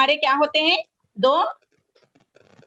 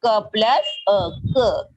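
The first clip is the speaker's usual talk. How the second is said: slow sound blending; 5 semitones lower, much slower than usual. Shown as a demonstration. Teacher showing children how sounds join, slowly. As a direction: stretch out क plus अ very slowly. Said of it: "किसके बाद?".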